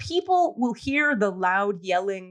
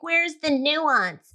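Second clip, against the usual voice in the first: slow, drawn-out, higher mocking voice; sarcastic impression of an overthinking liberal; mock whiny question; 4 semitones higher, much slower than usual. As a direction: drag it out like a fussy pundit. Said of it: "And I'm going, like".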